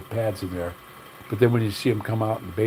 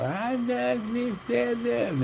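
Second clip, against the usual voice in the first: much higher, mocking put-on politician voice; mock Hillary Clinton; 14 semitones higher, 3 dB quieter, much slower than usual. Mocking a politician talking down to voters, high put-on voice. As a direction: squeak it out, mocking a politician's fake talk.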